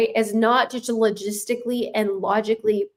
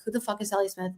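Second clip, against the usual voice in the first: confused; 10 dB quieter, faster than usual. Retold or imitated. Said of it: "And you're like".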